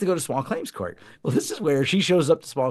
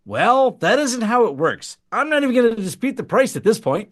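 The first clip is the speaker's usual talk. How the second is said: exasperated judge; 8 semitones higher, 5 dB louder than usual. Retold or imitated. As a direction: play the fed-up judge. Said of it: "And he says".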